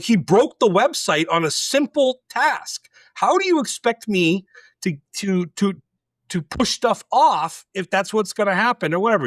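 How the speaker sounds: high-pitched indignant argument; acted-out frustrated objection; retold exasperation. Retold argument with boss over hire.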